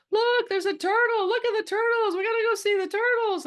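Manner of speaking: very high, loud excited squeal; tourists shouting, screaming. Acted. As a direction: scream like a boatload of thrilled tourists. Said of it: "shouting and screaming".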